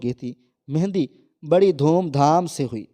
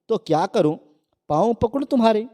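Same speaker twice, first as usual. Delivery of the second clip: rude sarcastic jab rising higher; mock him with scorn; rude, sarcastic retort to her fiancé; rudely; 6 semitones higher than usual. A narrator's reading. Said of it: "شہزادی بدتمیزی کرتے ہوئے بولی".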